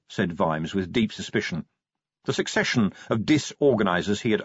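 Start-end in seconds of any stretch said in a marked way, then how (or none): none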